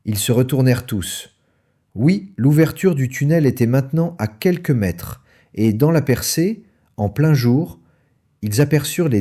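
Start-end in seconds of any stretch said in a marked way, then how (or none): none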